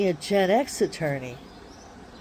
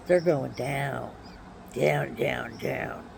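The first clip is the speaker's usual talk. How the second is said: low, sinking vow, slowed and fading quieter; fading threat; 6 semitones lower, 6 dB quieter, slower than usual. Real, not acted. Vowing the township will pay, low fading threat. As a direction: let each down sink lower and softer.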